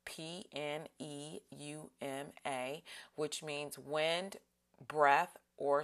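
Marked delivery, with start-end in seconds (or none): 0.1-2.8 spelled out. Shown as a demonstration. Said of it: "write this down".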